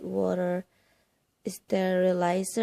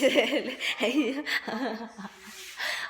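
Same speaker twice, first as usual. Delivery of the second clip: laughing at the pain; 2 semitones higher than usual. Real, not acted. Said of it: "I'm laughing at myself because it really hurts".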